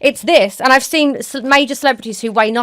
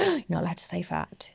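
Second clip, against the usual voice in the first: very soft, mock shocked; hushed scandalized reproach; 4 semitones lower, 14 dB quieter than usual. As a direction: imitate someone hushing a forbidden word. Imitates scandalized person's hushed reproach.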